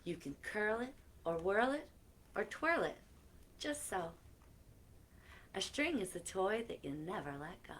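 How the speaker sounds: lilting storybook reading; reading to children. Acted. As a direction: read the rhyme to the kids with playful lilt. Reading new book aloud.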